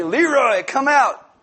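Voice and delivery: high, loud summoning call; demonstrated command. Shown as a demonstration. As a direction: call out with mock-solemn authority. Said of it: "You gotta say it like that".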